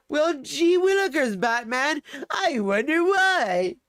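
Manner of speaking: slow, high, hammed-up Robin; gee-whiz sidekick, drawn out and high. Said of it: "I had to make that reference".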